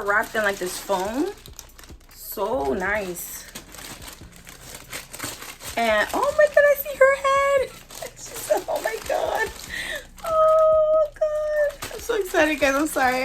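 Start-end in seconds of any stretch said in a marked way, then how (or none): 6.1-12.6 very high squeal breaking into a laugh; overwhelmed, squeaky real excitement; really excited. Real, not acted. Vlogger glimpsing the doll's head, squealing with excitement. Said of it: "I'm just really excited".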